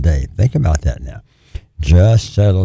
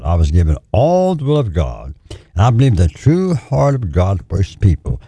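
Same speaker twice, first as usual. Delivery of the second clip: ordinary talk, nothing marked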